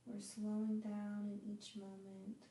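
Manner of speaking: slow, drawn-out phrases with long pauses; guided meditation. Acted. Yoga teacher guiding students to relax on their mats, guided meditation.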